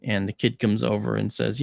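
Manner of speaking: usual speaking voice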